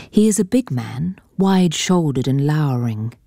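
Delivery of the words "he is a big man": ordinary delivery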